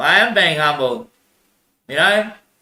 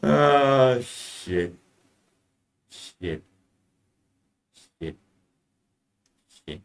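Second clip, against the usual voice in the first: muttered curse; 4 semitones lower, 7 dB quieter, much slower than usual. Real, not acted. Curse after laughing.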